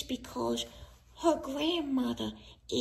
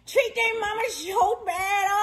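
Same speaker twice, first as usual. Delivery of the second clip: hammed-up tearful lament; theatrical self-pitying cry; dramatic wailing; 3 semitones higher than usual. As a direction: keep wailing in mock agony. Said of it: "Quit with the dramatics".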